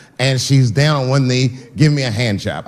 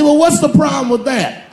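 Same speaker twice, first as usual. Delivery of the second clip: executive asking; 10 semitones higher than usual. Retold or imitated. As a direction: shout the question in a put-on voice. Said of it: "He said".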